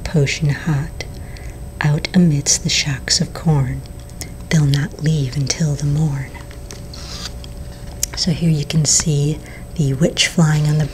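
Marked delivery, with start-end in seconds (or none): none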